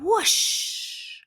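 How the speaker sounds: usual speaking voice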